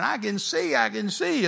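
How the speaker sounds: high, excited cry of a healed person. Retold or imitated. Healed people running to the platform, high excited cry. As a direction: cry it out high, amazed at the healing. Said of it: "people would run to the platform saying".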